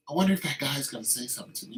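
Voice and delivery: ordinary delivery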